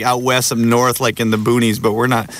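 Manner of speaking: normal talking voice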